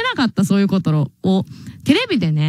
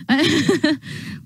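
embarrassed laugh; 5 semitones higher, 3 dB quieter than usual. Real, not acted. Laughs after greeting passerby.

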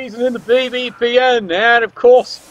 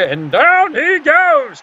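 slow call; 2 semitones higher, slower than usual. Acted. Commentator calls the Ogre toppling with a slow drawn-out fall. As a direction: stretch it out like a tree coming down.

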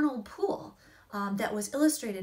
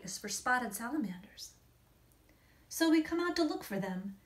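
gentle, hushed storybook reading; story narration. A narrator's reading. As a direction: read it like a bedtime picture book. Reads picture book aloud.

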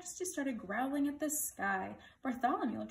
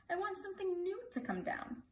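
higher on WANT, falling to the end; mildly demanding king; 8 semitones higher than usual. A narrator's reading. King telling page boy what he wants, demanding. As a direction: make a royal demand of "I want something new to come down".

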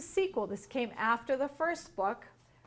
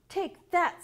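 higher, louder storybook exclamation; triumphant attack cry; 8 semitones higher, 5 dB louder than usual. A narrator's reading. Pete attacking the bad guy, triumphant cry. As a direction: punch it out like a hero.

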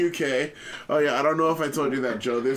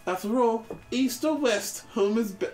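higher-pitched, doting mum voice; game mother; 6 semitones higher than usual. Acted. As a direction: play a fussing British mum.

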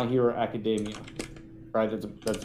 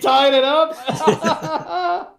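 laughing; 12 semitones higher, 5 dB louder, much slower than usual. Real, not acted. Stream poll gets trolled, laughing.

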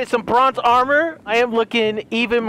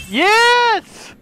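much higher loud cheer; victory cry; 12 semitones higher, 4 dB louder than usual. Real, not acted. Gamer finishes taming the horse and cheers. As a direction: cheer the win.

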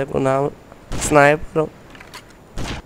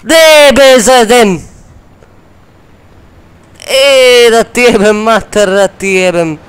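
high, loud furious cursing; furious yelling; 10 semitones higher, 13 dB louder, slower than usual. Real, not acted. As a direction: scream curses at the screen. Gamer raging at game.

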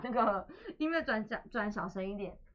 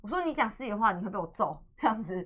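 ordinary delivery

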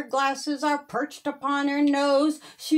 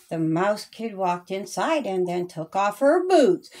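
ordinary delivery, nothing marked

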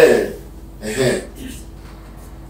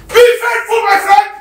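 much higher and louder preaching shout; fervent shout; 6 dB louder than usual. Real, not acted. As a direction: hurl it at the whole church. Preacher erupting at excuse-makers.

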